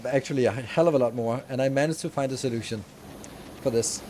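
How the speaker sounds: normal talking voice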